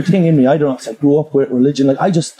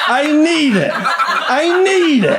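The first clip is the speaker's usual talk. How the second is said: pleading; 10 semitones higher, slower than usual. Real, not acted. Desperate repeated plea.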